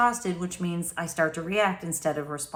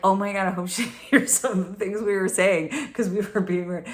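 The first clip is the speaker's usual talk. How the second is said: giggling quote. Retold or imitated. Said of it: "we're like".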